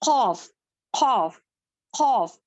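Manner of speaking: खौफ thick and loud, tongue root pressed up; heavy letter demo. Shown as a demonstration. Teacher drilling the heavy खौफ. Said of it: "इसको मोटा ही पढ़ेंगे".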